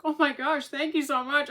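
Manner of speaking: a touch of a nurse gushing with thanks; overjoyed thanks. Retold or imitated. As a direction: burst out with grateful delight. Nurse overwhelmed by praise.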